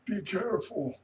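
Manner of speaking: high, far-off mock warning, falling on careful; light impression of George cautioning. Friend imitating George saying be careful. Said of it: "that's my George".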